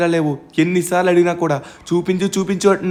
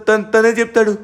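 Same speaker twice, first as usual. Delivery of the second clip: usual speaking voice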